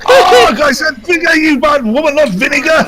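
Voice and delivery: high, loud hammed-up scolding; shrieking mock reprimand; mock outraged shout. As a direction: yell at her like a scandalised prude. Scolding a game character, mock outrage.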